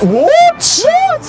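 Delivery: high, shrill disbelief; shocked what. Pretend. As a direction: squeal it in mock shock. Insulted by customer.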